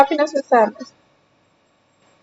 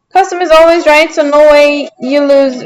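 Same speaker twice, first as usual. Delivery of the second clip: ordinary talk, nothing marked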